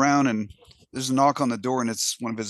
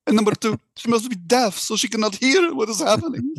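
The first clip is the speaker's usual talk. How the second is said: the Pope wailing his refusal in a put-on accent; mock accent Pope; 10 semitones higher than usual. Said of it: "I'm gonna do an accent too".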